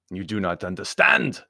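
insist, lifting on understand; insistent Baron. A narrator's reading. Baron objecting to Anthony, insistent and rising. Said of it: "said the Baron".

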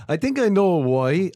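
"why" in an Irish accent. Real, not acted. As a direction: say "why" with a thick Irish vowel. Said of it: "correcting you saying why".

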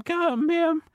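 put-on very high squeaky teenage clerk; a fussy teenager in falsetto. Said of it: "The teenager".

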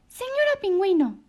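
a tiny bird hailing the giraffe; little bird voice. A narrator's reading. Choco calls the penguin.

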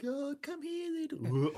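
very high mock baby talk to an animal; cooing tourist. Naive tourist calling a wild bear over, high mock coo. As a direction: coax the wild animal like a pet.

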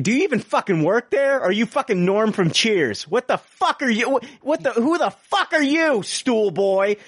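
high-pitched, incredulous berating; indignant tirade. Acted. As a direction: tear into the idle employee, voice climbing. Acted tirade at store employee.

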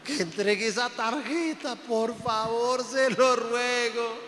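hammed-up tearful pleading; imitating God crying. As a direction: whine in exasperation through fake sobs. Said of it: "me imagino a Dios llorando".

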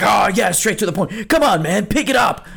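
hammed-up drill coach pushing the kid; a hint of a harsh yelling coach; pushy tough coach. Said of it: "if I was going to turn into this".

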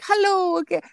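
very high, chirpy greeting; cheerful coworker. Retold or imitated. Filipino coworker greets everyone in the morning, mock cheerful. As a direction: imitate an over-cheerful morning person. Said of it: "they're so cheerful too".

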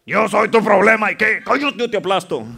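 hammed-up bullying monster voice; threatening mountain. Mountain threatening to crush a timid believer, bullying. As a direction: play the mountain daring them to try. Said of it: "El monte le está diciendo a ustedes".

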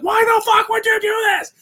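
high, loud furious yell; screaming at his wife in the dark hallway, a high shout; retold scream. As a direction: scream it at her in a rage. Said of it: "I screamed so loud at Sarah".

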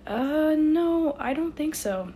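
high, loud, drawn-out uh no; doubtful. A narrator's reading. Ainsley answering the odd bow, doubtful. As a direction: stretch it out, unconvinced.

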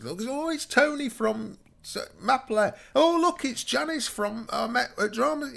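high, drawn-out mock delight at spotting someone; excited greeting. Storyteller imitating friends spotting people at a festival, high slow exclaiming.

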